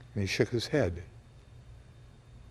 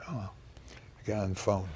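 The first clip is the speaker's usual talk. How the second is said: ordinary talk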